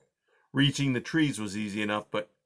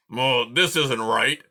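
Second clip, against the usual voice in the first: slow, louder porcupine growl; growling with rage; 5 dB louder, slower than usual. A narrator's reading. Aerith realizing he's lost, growling in rage. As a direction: growl it out, seething. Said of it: "he growled with rage".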